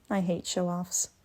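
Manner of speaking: normal talking voice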